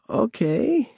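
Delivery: put-on high, creaky old woman; lightly voiced crone. A narrator's reading. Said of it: "she said".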